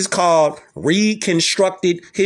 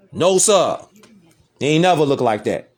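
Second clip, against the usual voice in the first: usual speaking voice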